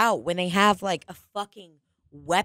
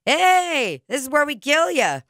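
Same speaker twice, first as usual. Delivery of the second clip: imitating Mark Normand; 7 semitones higher, 3 dB louder than usual. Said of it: "Mark Normand goes".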